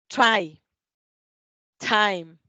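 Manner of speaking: "try", "time" with air bursting out on the t; loud model words "try", "time", each t blown; aspirated initial T. Shown as a demonstration. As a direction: release the t of "try" and "time" with breath. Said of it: "Dejamos salir aire".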